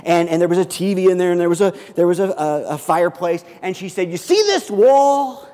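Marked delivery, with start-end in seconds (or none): 4.1-5.5 a slow, higher, louder teasing question; lightly voiced girlfriend, drawing it out; playful girlfriend setting up a surprise; girlfriend's reveal. Girlfriend showing the wall.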